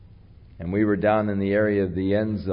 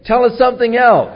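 mock eager shout, much higher and louder; eager crowd imitation; 14 semitones higher, 9 dB louder than usual. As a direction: imitate a crowd clamouring for more. Mimics eager crowd calling for more.

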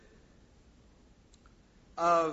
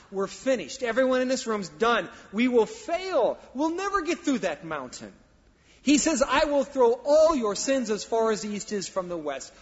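loud, higher preacher's cry building up; preaching at full force; 5 semitones higher, 8 dB louder than usual. Acted. Pastor warning the congregation, loud and climbing. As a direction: drive it home to the room.

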